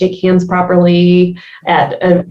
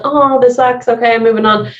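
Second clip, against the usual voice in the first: mock kid grumble, starting higher; imitated kid's complaint; 4 semitones higher than usual. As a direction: grumble like a kid, then shrug it off. Voicing herself as a kid.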